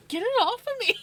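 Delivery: squeaky high, loud dog voice; high voiced dog. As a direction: voice the startled dog shrilly. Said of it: "Vinny's like".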